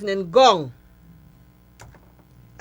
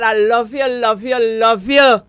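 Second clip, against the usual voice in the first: louder and louder with each "love you"; building declaration; 8 dB louder than usual. Real, not acted. To her friend the judge Carol Holder, building louder love.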